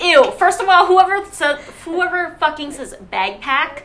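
high, loud indignation; mock disgust. Real, not acted. Friend objecting to a pronunciation, loudly disgusted. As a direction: object loudly, appalled at the word.

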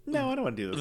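high, soft whiny refusal. Retold or imitated. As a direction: whine it like a bored star.

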